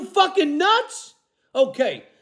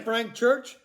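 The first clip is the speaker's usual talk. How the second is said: ordinary delivery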